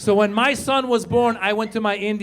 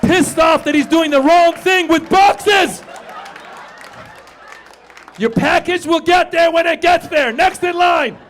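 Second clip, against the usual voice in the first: grumpy clerk yelling; 8 semitones higher, 8 dB louder than usual. Retold or imitated. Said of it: "There's a guy".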